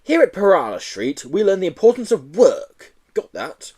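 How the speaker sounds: lightly voiced bossy headmaster; stern headmaster. Headmaster lecturing the new boy, mildly stern.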